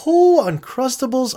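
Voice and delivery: Oh, Uncrustables, high and loud, drawn out; light up like you've just seen your favorite snack; excited gasp. Real, not acted. Vlogger to viewers, remembering Uncrustables, thrilled high exclaim.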